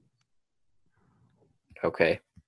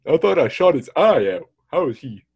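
higher-pitched astonished fake German soldier; bad German accent; 6 semitones higher than usual. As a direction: botch a German accent in mock disbelief. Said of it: "that's not a German accent".

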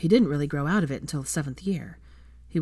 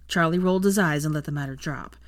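plain reading voice

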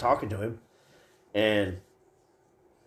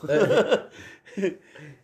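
loud high giggle; laughing at his own stoned story, high and loud; 10 semitones higher, 4 dB louder than usual. Real, not acted.

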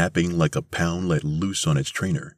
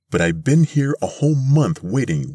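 put-on high, louder old-lady protest; complaining old lady; 9 semitones higher, 5 dB louder than usual. A narrator's reading. An old lady protests the month of waiting, complaining. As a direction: complain in a raised old woman's voice. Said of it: "one old lady says".

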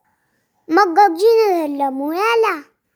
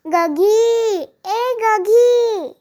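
long drawn-out call across the yard; 4 semitones higher, much slower than usual. A narrator's reading. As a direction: call her name from far off, stretched. Old man calls wife from field. (दूरवरून लांब हाक)

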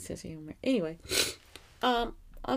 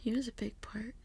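soft unvoiced whisper; tearful whisper; 3 semitones higher, 6 dB quieter than usual. Real, not acted. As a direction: hold back tears, whisper it. Grief, after long pause.

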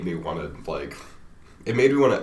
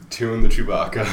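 loud silly pun; goofy shout; 5 semitones higher, 12 dB louder than usual. Pretend. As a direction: belt out the joke.

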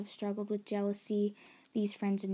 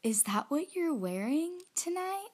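imitated doubtful visitor; 7 semitones higher than usual. Visitor questioning the outfit before a meeting, mock doubtful. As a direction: imitate someone querying the outfit. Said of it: "she said".

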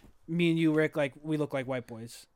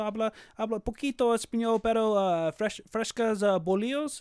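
put-on higher, hesitant ordering in a Mexican Spanish accent; 5 semitones higher than usual. Asking the bakery lady for fresh bolillos in a Mexican Spanish accent. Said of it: "let me say it in the accent".

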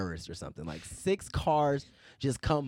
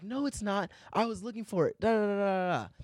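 much higher, shrill accusing woman's voice; hammed-up voice of an accusing drunk lady; 10 semitones higher than usual. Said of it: "She's like".

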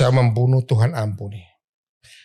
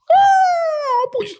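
crying husband; 4 dB louder, much slower than usual. Retold or imitated. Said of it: "suaminya nangis".

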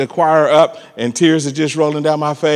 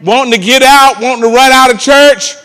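slow, loud rising preacher cadence; climbing preacher delivery; preaching build; 9 semitones higher, 7 dB louder than usual. Acted. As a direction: build each line with more force.

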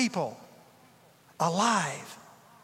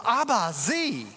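put-on toddler voice; 4 semitones higher, slower than usual. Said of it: "the little boy goes".

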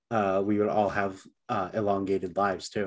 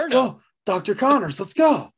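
high, loud hype cheer; frat bro; 12 semitones higher, 5 dB louder than usual. Real, not acted. Hyped cheer.

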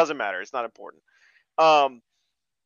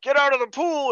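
higher-pitched hammed-up command; mock heroic order; 9 semitones higher, 3 dB louder than usual. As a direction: bark it like a B-movie hero. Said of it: "is like".

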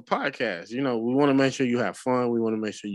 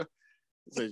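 usual speaking voice

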